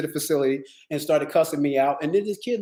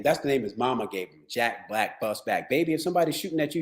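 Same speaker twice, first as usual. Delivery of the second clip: normal talking voice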